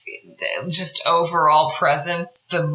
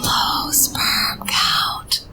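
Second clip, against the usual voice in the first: whisper; 3 dB quieter, much slower than usual. Pretend. Joke whispered during story recap.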